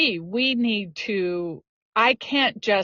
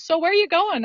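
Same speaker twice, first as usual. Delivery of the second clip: much higher, lightly voiced mentor; mentor's teasing question; 9 semitones higher, faster than usual. Barrel racing mentor quizzing a cocky rider, high and teasing. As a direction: pitch it way up as the amused mentor. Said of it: "she goes".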